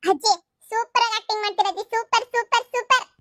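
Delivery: put-on high, excited child's cheer; teasing praise. Child tells grandma her acting is super, high teasing praise.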